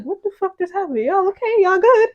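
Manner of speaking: confused. Retold or imitated. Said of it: "I was like".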